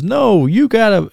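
high-pitched mock protest; imitated referee's refusal. Referee refusing the three count, high imitated protest.

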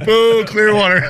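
high, loud jeering boo; mock booing crowd. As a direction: boo them like a mocking crowd. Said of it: "It's like".

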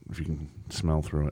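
soft whispered jab; whispered aside. Real, not acted. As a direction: drop to a whisper for the joke. Quiet jab about the smell.

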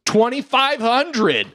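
high, drawn-out bidding cry; obnoxious interrupting bid. Retold or imitated. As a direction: cut in with a mock auction call, slowly. Heckler interrupts with higher bid.

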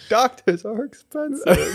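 high, slow squeaky voice; a squeaky yokel lament; high character voice. Pretend.

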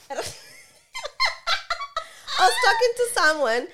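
laughing hard while talking. Real, not acted. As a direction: talk through big laughter. Laughing at funny story.

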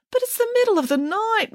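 very high-pitched whine; moaning complaint. A narrator's reading. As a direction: moan in protest, way up high. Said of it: "moaned Mary".

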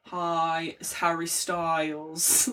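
put-on a light, slow [name] British accent; lightly voiced [name], slow and soft British accent; [name] impression. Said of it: "do it like [name], where it's like".

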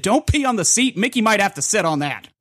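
mild mock yell at kid; half-hearted yelling dad; retold dad yell. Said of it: "just yell to his kid".

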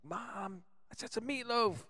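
put-on high, whiny man-child call; Will Ferrell impression. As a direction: yell at mom from the basement. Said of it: "And he's like".

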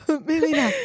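Maylena said high, through a laugh; laughing name. Shown as a demonstration. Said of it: "There we go".

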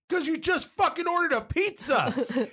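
high, slow, through laughter; laughing retort. Retold or imitated. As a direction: blurt it out while cracking up.

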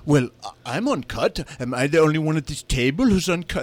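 bad South African accent, lightly done. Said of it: "That's my terrible South African accent".

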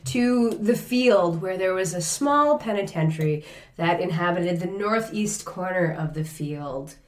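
hushed storyteller narration; story narration. A narrator's reading. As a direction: narrate softly, fading to quiet. Narrates the scene.

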